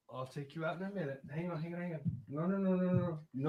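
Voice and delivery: high, soft pet-talk pleading; talking to the dog. Real, not acted. Owner softly begging his restless dog to wait. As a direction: coax the dog gently, voice up high.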